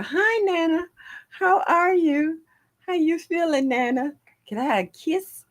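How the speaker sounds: put-on high, sweet little-girl voice; toddler voice. Grandmother imitating her great-granddaughter, high toddler voice. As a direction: chirp it like a two-year-old asking for a kiss.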